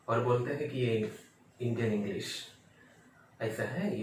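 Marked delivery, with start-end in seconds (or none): none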